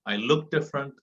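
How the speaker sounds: ordinary delivery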